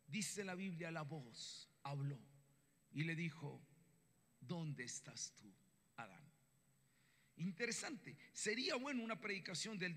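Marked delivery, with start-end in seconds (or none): 4.4-6.2 low, soft and slow question; speaking, not shouting. Acted. God calling Adam after the sin, soft and unshouted. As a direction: ask it gently in a much lowered voice. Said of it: "y habló", no gritó".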